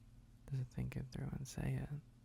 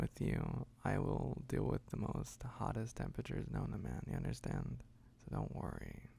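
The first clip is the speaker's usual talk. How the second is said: whispering; 2 semitones lower than usual. Acted. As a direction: whisper it close and calm. Whispered reassurance.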